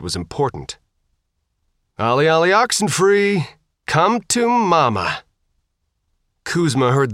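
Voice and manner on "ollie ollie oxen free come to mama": taunting call-out. A narrator's reading. Girl luring zombies, calling out.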